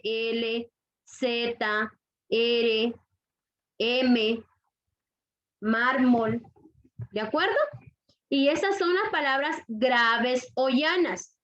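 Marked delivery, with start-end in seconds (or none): 5.6-6.4 "mármol" with weight on "már"; stress on penultimate syllable. Shown as a demonstration. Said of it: "En la penúltima sílaba".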